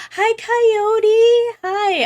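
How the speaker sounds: high, bright burst of hello; excited greeting. Real, not acted. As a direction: greet a friend with delight. Greeting a viewer by name.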